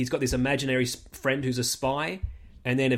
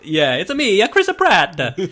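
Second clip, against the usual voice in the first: mock Mario voice; 6 semitones higher, 7 dB louder, slower than usual. Mocking Chris Pratt's Mario voice.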